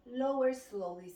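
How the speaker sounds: ordinary talk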